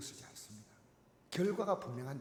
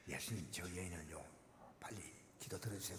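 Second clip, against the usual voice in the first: hushed disciple, much lower and softer; whisper; 10 semitones lower, 14 dB quieter than usual. Acted. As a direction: whisper urgently to Jesus about the woman. (제자들이 예수님께 속삭이며 재촉하듯)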